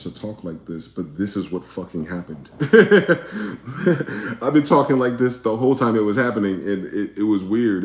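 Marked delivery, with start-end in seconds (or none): 2.5-4.4 sudden loud burst of laughter. Real, not acted. Streamer cracks up at his own words, loud laugh. 4.4-7.8 louder, animated. Real, not acted. Keeps talking through laughter.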